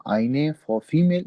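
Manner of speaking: आईने said slowly, long ई; slow clear आईने for female; pronunciation demo. Shown as a demonstration. Teacher to class, slow model word. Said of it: "female के लिए आईने use करते हैं".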